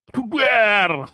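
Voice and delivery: sudden high shouted burst; short loud high yelp; blunt exclamation. Real, not acted. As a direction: blurt it out with glee.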